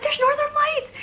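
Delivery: high, thrilled squeal; squealing to wake boyfriend about the lights; excited. Retold or imitated. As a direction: blurt it out, shaking with excitement. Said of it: "I was shaking".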